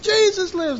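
high-pitched answering call; retold crowd response. Preacher imitating the congregation's reply, high-pitched call. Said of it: "And they said".

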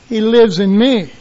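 normal talking voice

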